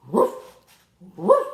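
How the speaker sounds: short loud high woof; playful barking woofs for children; dog bark. Pretend. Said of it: "don't forget to bark".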